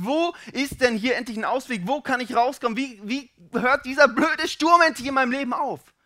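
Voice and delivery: loud, high, exasperated pleading; fed up and urgent, building in force; desperate questioning. Acted. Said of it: "fragst dich".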